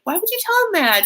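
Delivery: upset whine. Retold or imitated. Little sister scolds her mean older sister, mock wounded. Acted upset girl complaining.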